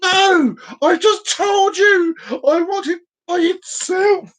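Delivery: high, drawn-out indignant outburst; exasperated customer at the end of their patience; acted high-pitched exasperation; exasperated retort. Acted exasperated reply to cashier.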